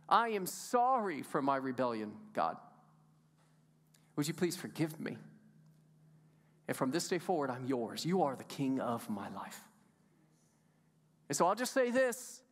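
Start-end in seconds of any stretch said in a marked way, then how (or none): 0.1-9.7 low, hushed confession to God; humble prayer. Acted. Sinner's prayer voiced as example.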